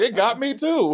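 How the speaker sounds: high-pitched comic reaction; mock astonishment. Co-host retells his reaction to the lyrics, mock astonishment. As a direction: pitch it up in playful surprise.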